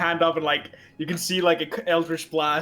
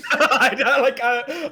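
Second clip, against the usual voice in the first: louder, higher through real laughter; can barely get words out for laughing; 11 semitones higher, 7 dB louder, slower than usual. Real, not acted. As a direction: player cracking up, louder and higher. Player bursts out laughing.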